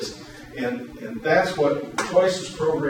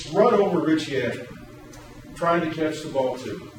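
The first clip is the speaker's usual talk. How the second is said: ordinary delivery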